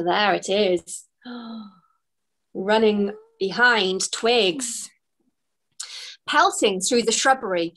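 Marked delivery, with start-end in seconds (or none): 1.2-4.9 slow, hushed dramatic gasp; mock gasp. Reader shows the stoat chasing Twigs, a dramatic gasp. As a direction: gasp then say it slowly with suspense.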